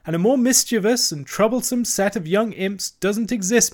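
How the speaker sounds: high, chattering washerwoman voice; Toad as washerwoman. A narrator's reading. Toad playing a washerwoman to the barge woman, high and fussy. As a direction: pile on the hard-luck tale, pitched high.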